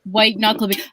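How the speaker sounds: ordinary talk, nothing marked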